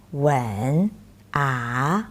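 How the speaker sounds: "吻" slow, low and soft; third tone. Shown as a demonstration. Teacher warning the class that the low "吻" means kiss. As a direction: sink "吻" and "ah" down low. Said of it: "If you pronounce like".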